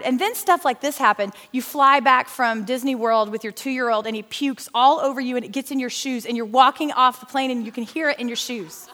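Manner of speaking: ordinary talk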